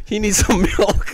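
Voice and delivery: laughing while teasing. Real, not acted. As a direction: tease him through laughter. Teasing friend eating spicy food.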